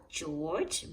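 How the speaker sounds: ordinary delivery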